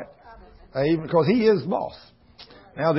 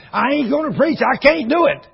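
high, louder insistent no; defiant refusal; 10 semitones higher, 4 dB louder than usual. Retold or imitated. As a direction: dig in and refuse God. Young man refuses God's call.

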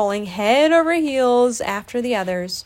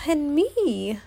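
higher, mildly forlorn little voice; forlorn sigh; 5 semitones higher than usual. A narrator's reading. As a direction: add it as a small, sorry afterthought. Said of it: "a quivering sigh".

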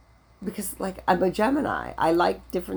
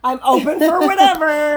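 high, louder giggling offer; laughing mock flirt; 7 semitones higher, 5 dB louder than usual. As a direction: play along with a laugh. Joins mock come-on laughing.

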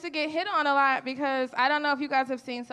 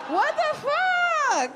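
much higher, very slow stunned what the fuck; shocked disbelief; 14 semitones higher, 3 dB louder, much slower than usual. Real, not acted. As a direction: stretch it out, high and stunned. Shocked reaction to crack pipe.

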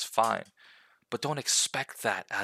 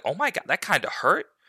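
imitating a startled kid; 7 semitones higher, 8 dB louder than usual. Acting out a kid after punching.

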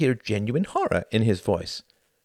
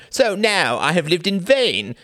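strained sardonic; 11 semitones higher, 7 dB louder than usual. A narrator's reading. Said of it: "striving for a sardonic tone".

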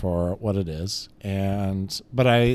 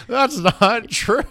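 high, slow, laughing denial; 12 semitones higher, 5 dB louder, much slower than usual. Real, not acted. Laughing at mom's excuse.

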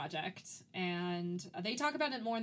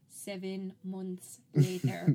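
slow, louder cartoon narrator impression; SpongeBob; 6 dB louder, much slower than usual. Reenacting the shout-out at the movie screen, slow cartoon narrator impression. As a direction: draw it out like a cartoon time card. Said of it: "both Keith and I did the SpongeBob".

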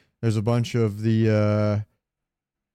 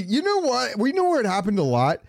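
much higher, wound-up voice; excited mock panic; 9 semitones higher than usual. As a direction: act out a player spooked by the monster. Excited imitation of player.

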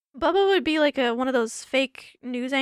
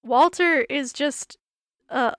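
ordinary talk

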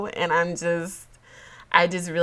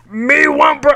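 loud, high, goofy monster voice; Cookie Monster voice; 8 semitones higher, 12 dB louder than usual. Acted. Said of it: "in my Cookie Monster voice".

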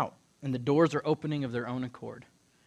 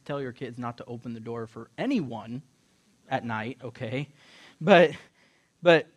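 ordinary talk, nothing marked

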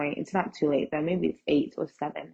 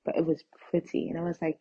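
ordinary talk, nothing marked

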